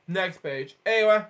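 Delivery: ordinary delivery, nothing marked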